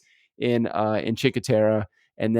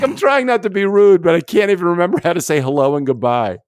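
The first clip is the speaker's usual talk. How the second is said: higher, louder, fast mock protest; a flustered tourist defending himself, raised and fast; exasperated protest; 7 semitones higher, 8 dB louder than usual. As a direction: plead your case in a hammed-up panic. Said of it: "I'm like".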